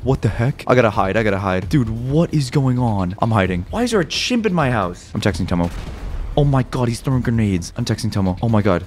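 ordinary delivery

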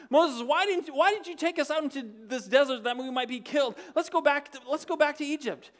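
mock whining Israelites; complaining. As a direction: whine at Moses like grumbling people. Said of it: "started complaining to Moses".

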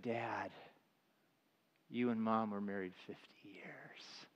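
soft whisper to a confused father; quiet reassuring whisper; tender whisper. Retold or imitated. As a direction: hush it gently to Dad.

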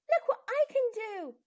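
high, bright surprised exclaim; a child amazed at her own skipping. A narrator's reading. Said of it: "she said in surprise".

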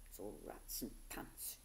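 low, slow whispered grumble; muttering. Acted. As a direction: grumble it under your breath, slowly. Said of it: "she muttered".

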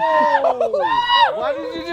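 high, drawn-out outraged shout; shrieked protest. Real, not acted. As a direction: wail it high and slow in protest. Upset over a grabbed hat.

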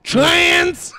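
sudden high, very loud shout; mock outburst. Comic derailing a pretend radio interview, shouted outburst. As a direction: blurt it out at full yell.

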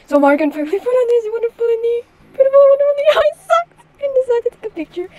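high, excited storytelling. Acted. Narrating the sock reveal, excited. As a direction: pitch up with giddy energy.

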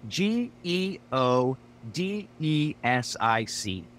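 slow, letter by letter; spelling it out. Acted. Pete spelling for his confused friend, slow and deliberate. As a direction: spell it slowly, each letter separate. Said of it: "do I have to spell it out".

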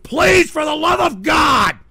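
slow, loud, high begging shout; exasperated plea. Real, not acted. Streamer begging viewers to leave text-to-speech alone, exasperated shout. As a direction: beg at full volume, drawn out. Begs trolls to stop.

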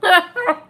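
loud high pained laugh; laughing through pain. Real, not acted. Mask pulling at skin.

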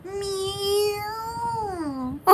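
soft, long drawn-out meow; doing a cat. Pretend. Showing off a cat impression, soft meow. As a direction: mew softly like a cat. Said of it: "I'm doing a cat".

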